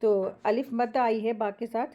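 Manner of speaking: normal talking voice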